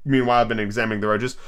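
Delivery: usual speaking voice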